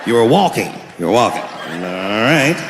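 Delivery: put-on a skeptical old cop taking a report; weary veteran cop. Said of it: "if you was a police veteran taking this kid's police report".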